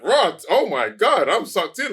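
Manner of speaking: stretched-out, loud, swept-up reaction; caught-up enthusiasm. Retold or imitated. Fan reacting to the director's hype, excited and sucked in. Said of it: "his enthusiasm for me".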